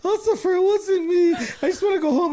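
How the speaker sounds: very high, loud, blubbering wail; hammed-up crybaby wail; mock sobbing plea. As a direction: ham up a grown man bawling to the cop.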